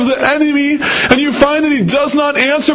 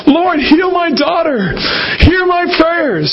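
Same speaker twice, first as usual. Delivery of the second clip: pleading mother; 7 semitones higher, slower than usual. Acted. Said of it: "so she kept on saying it".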